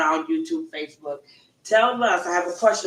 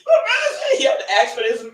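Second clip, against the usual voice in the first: laughing so hard it cracks; laugh-crying through words; 8 semitones higher, 4 dB louder than usual. Real, not acted. Laughing while telling.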